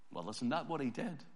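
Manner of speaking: ordinary delivery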